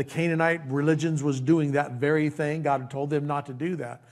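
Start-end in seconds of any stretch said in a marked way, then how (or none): none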